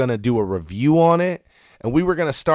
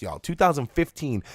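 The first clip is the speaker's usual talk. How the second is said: ordinary talk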